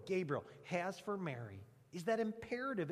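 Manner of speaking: ordinary talk, nothing marked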